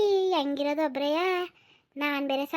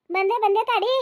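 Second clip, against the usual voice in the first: calling back; 6 semitones higher, 4 dB louder, slower than usual. Acted. Answers the call from afar.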